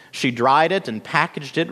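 usual speaking voice

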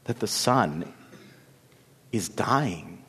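soft, low, halting confession; whispered sorrow. A narrator's reading. Lily confides her heart's sorrow to her sisters, hushed. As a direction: let it drop away with long pauses. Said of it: "Lily whispered the sorrow of her heart".

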